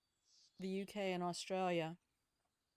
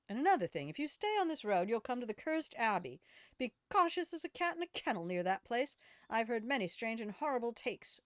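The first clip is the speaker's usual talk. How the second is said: higher-pitched, animated storybook local; cautioning game villager; 4 semitones higher, 4 dB louder than usual. Acted. Villager warning a traveller off the Cursed Abbey, animated character voice.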